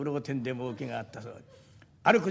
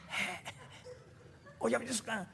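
softer, discreet answer; hushed reply; 7 semitones higher, 7 dB quieter than usual. Retold or imitated. Junkman answering the hidden woman, hushed. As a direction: answer softly as if it's a secret. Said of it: "こっちも小さい声で返事しなきゃ".

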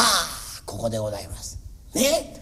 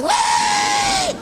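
long called-out reply; much slower than usual. Acted. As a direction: hold the cry out high and slow. Servant answers the master's call.

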